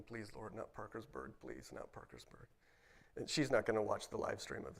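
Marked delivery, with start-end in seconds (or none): 0.0-2.5 much softer, sinking to a whisper; hushed pleading prayer. Retold or imitated. Man praying in his mind against Parkersburg, hushed pleading. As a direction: plead privately under your breath.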